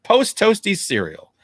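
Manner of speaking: upbeat podcast sign-off; announcer voice. Acted. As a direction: announce the outro like a show host.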